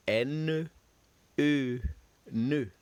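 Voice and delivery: N, A, "na" spelled then said as a syllable; French syllable drill. Shown as a demonstration. Teacher models French syllables for students to repeat. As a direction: sound out N, A and then "na" slowly for the class. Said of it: "Repeat after me".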